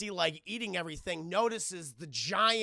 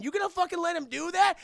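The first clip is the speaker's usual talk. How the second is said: goading yell; 11 semitones higher, 9 dB louder than usual. Retold or imitated. Randall goading, inciting a fight.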